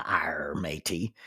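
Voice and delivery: low pirate accent with drawn-out R. Shown as a demonstration. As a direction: stock pirate catchphrase accent, pitched low. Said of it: "the pirate accent".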